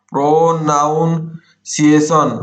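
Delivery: ପ୍ରନାଉନ୍ସିଏସନ drawn out in slow chunks; syllable-by-syllable dictation. Shown as a demonstration. Teacher writing the word out for students, slow syllabled dictation. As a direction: break ପ୍ରନାଉନ୍ସିଏସନ into long, separate syllables.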